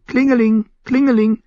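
high sing-out bell jingle; high-pitched tinkling. A narrator's reading. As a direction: imitate little bells in a high voice. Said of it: "die Glöckchen auf seinem ... Geweihhelm machten".

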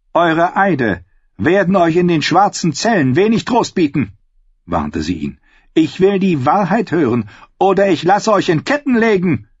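queen threatening her maester; menacing queen. Acted. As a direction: promise chains calmly.

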